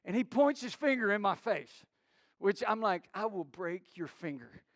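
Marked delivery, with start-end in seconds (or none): 3.1-4.6 low, soft mock menace; quiet inner warning, pitched down; muttered threat. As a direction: threaten under your breath. Retold silent threat to finger pointer.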